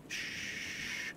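whispered shush; breathy hospital-bed shush; soft shush. Acted. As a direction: hush them gently with breath. Soothing a hurt patient.

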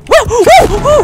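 loud, high surprised cry; startled whoa. Real, not acted. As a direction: jump back in surprise. Startled by a whale.

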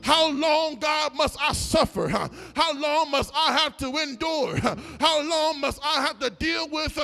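full-force preaching. Acted. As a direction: lift the church, call it out. Preaching returns over music.

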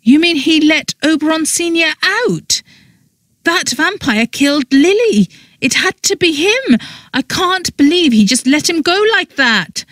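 high-pitched incredulous outrage; shocked and angry. A narrator's reading. Freed woman hearing vampire was released, shocked and angry. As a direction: protest in strained disbelief. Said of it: "I was shocked".

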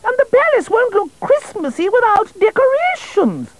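a busy queen brushing the king off; fussy queen voice. Acted. Queen calling from the kitchen to the king, high and fussy. Queen upset about ruined decorations.

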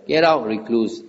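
ordinary talk

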